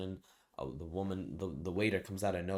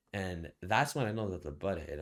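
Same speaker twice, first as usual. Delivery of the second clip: ordinary talk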